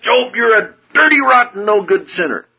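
scolding God. Retold or imitated. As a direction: thunder down a scolding. God rebukes Job harshly.

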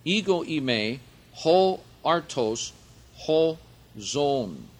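"Ego eimi, ho artos, ho zon" said slowly as ee-GO ee-MAY, ho AR-tos, ho ZON; Greek pronunciation. Shown as a demonstration. Teacher to Bible class, careful Greek pronunciation. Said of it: "So I'll pronounce that for you".

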